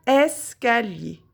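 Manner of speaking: "Escalier" said slowly with a long opening "Es"; stressed first syllable. Shown as a demonstration. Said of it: "on entend le son est dans la première syllabe".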